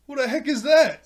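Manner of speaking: high, loud mock outburst; startled yell. Beast Boy hears someone behind Raven's door, startled yell. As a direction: yell it through a closed door in alarm.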